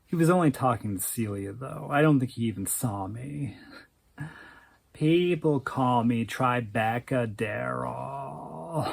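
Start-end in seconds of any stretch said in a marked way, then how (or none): 4.9-8.9 slow, soft, whiny stoner drawl. Acted. Stoner Daryl introducing himself to Celia, whiny slow drawl. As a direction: stretch it out like a stoned slacker. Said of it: "whiny stoner dude drawl".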